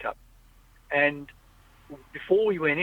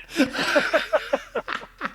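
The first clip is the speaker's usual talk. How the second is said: laugh; 11 semitones higher than usual. Real, not acted. Laughs again after the story.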